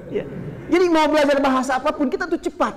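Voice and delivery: usual speaking voice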